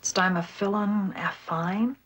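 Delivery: "Stymophyllon affine" sounded out slowly, syllable by syllable; careful pronunciation. Real, not acted. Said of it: "I don't think I can pronounce that name".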